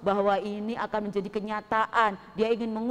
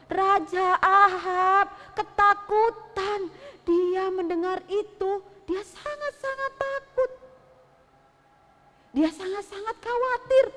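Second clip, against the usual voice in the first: frightened narration; 8 semitones higher, 3 dB louder, slower than usual. A narrator's reading. Narrating king's fear dramatically.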